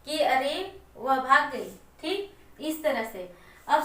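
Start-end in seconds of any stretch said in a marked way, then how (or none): none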